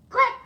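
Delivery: sharp, high, loud click noise; loud click sound. Acted. Amelia Bedelia's pin hits the floor with a loud click.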